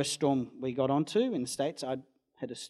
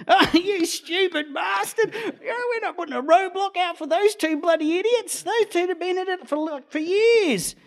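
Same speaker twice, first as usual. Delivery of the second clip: mock laughing cop; 9 dB louder than usual. Cop scoffing at the owner's roadblock claim, hammed-up laughing voice. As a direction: laugh it off like a cop in his undies.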